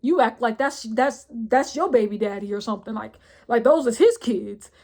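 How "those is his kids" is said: normal talking voice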